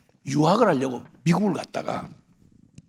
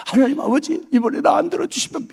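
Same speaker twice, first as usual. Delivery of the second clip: desperate plea; 7 semitones higher than usual. Retold or imitated. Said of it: "이러면서 막 간절하게".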